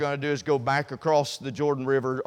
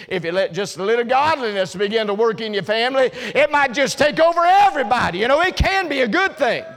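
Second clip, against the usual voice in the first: loud, much higher, fast preaching; full-force preaching; 8 semitones higher, 6 dB louder than usual. Acted. Preacher to congregation, fired up about the church as leaven. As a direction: drive it home to the congregation.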